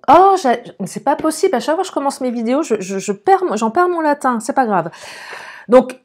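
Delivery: usual speaking voice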